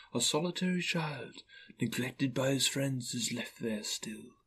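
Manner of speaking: hushed ghostly lament; sorrowful ghost. Acted. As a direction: let the pity die away into breath. Ghost sadly describes lonely child.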